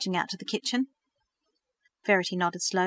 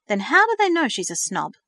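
louder, high pointed question; indignant; 5 semitones higher, 6 dB louder than usual. A narrator's reading. Verity defending her friend to Thomas, indignant. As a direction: challenge him, offended. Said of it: "Verity questioned indignantly".

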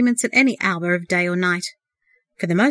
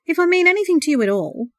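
higher, louder and terse; curt reply; 9 semitones higher, 4 dB louder than usual. A narrator's reading. The other brother shuts down the plea, curt and tense. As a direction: cut him short with a tight edge. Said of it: "one of the tall figures replied curtly".

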